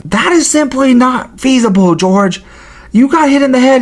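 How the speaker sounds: high-pitched, incredulous host impression; mock debunking, pitched well up; Joe Rogan. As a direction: imitate a podcaster shooting it down. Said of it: "Joe Rogan's like".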